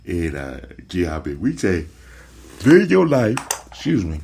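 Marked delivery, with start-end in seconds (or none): 2.6-3.4 words stretched by a loud yawn. Real, not acted. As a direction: say it mid-yawn. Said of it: "I'm yawning".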